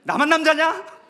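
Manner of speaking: very loud, high-pitched outcry; yelled rhetorical question; mock-indignant shout. Real, not acted. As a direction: shout it as a comic protest to the congregation. Mock protest to the congregation.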